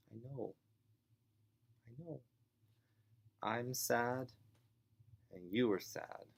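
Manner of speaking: compassionate mom. Acted. Mom to crying, screaming boy at home, compassionately. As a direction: gently share the child's sadness. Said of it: "she's gonna be compassionate too".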